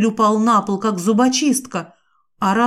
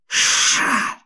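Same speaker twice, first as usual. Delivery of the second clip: sharp voiceless snake hiss; angry hiss; 5 semitones higher than usual. Acted. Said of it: "Незнакомец зашипел".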